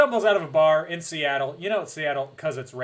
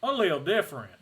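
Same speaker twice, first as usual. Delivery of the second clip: exaggerated Southern accent; 3 semitones higher, 4 dB louder, faster than usual. Host imitates Jasper telling Bella about vampires, exaggerated Southern accent. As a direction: stretch it into a hammed-up Texan twang. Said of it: "his accent gets more and more southern".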